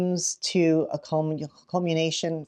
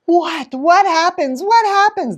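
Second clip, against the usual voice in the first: much higher, louder pleading; eager kids; 12 semitones higher, 8 dB louder than usual. Retold or imitated. Kids dying to know what happens, pleading. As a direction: beg like impatient children. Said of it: "They're all dying to know".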